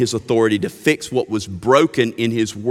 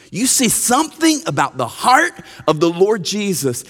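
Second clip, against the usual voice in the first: impassioned preaching; 8 semitones higher, 3 dB louder than usual. Acted. Preaching with rising force.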